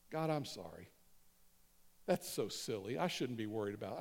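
low, soft aside to God; quietly confessing, as if praying privately; sheepish mock prayer.

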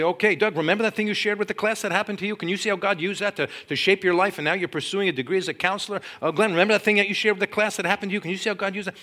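high, rapid-fire address to students; urgent teacher. Retold or imitated. Teacher calls on students by name while making pottery, excited urgency.

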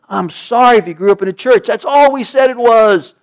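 loud, high preaching at full force; preacher on fire, pounding the message; fiery preaching. Acted. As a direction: drive each word home to the pews.

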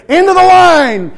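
slow, high, booming holler; long loud bellow over the crowd; airline lady calling out. Retold or imitated. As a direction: stretch it out like a queue marshal yelling. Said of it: "lady's standing there saying".

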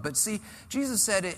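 normal talking voice

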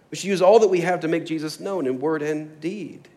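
loud, rallying preacher's voice; exhorting preaching. Real, not acted. Preacher urging church to serve like Jesus, exhorting preaching. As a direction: urge them on, building in pitch and force.

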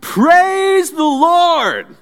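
put-on old lady shouting high and slow to the whole street; yelled praise. Old lady calls to the neighborhood, high slow yell. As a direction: ring it out like a joyful old lady on her porch. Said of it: "she would yell out for all the neighborhood to hear".

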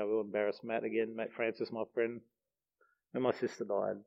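soft, choked-up voice on the edge of crying; fighting back tears; real grief breaking through quietly. Real, not acted. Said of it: "Sorry, getting a bit teary over this".

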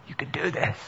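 soft, hushed reassurance; whisper. Retold or imitated. God whispering to Elijah in the cave, softly. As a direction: say it close and quiet like a breeze. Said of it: "he whispers in his ear".

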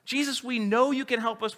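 high, loud, desperate begging of lepers; desperate pleading. Retold or imitated. Lepers beg Jesus to heal them, high and pleading. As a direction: cry out to Jesus for mercy.

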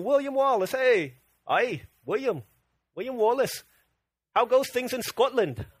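mock Scottish greeting to William Wallace; hammed-up, wobbly Scottish accent; rough Scottish accent. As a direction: hail a Highland hero in an amateur Scottish accent. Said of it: "I get my accents mixed up".

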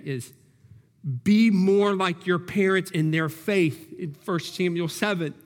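usual speaking voice

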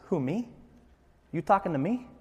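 hint of a mock Italian tough guy; soft, incredulous Italian-American questioning; lightly put-on Italian accent. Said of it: "I don't know why he's Italian".

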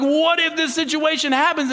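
high-pitched mock worry; high, hammed-up fretting; mock freak-out. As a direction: act out fretting over what might happen. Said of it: "we freak out so much".

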